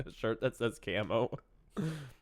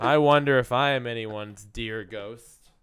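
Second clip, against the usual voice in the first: slow, earnest poetic reading; dramatic poem reading; 10 dB louder, slower than usual. Acted. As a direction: read it like sad poetry.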